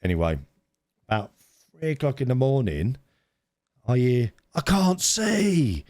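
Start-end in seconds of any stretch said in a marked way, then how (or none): 4.5-5.8 high, slow, dazed sleepy cry; high drawn-out sleepy wail; sleep-talking shout. Retold or imitated. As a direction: mimic a half-asleep teen calling out. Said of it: "like, a big shout".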